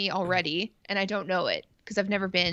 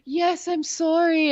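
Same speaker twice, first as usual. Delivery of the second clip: high, slow, louder hammed-up sorry; mock apology; 9 semitones higher, 5 dB louder, slower than usual. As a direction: overplay a guilty apology, high and drawn out. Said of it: "And he'd be like".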